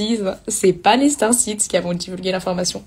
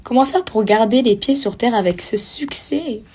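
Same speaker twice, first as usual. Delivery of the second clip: whispered. Real, not acted. Reading a question.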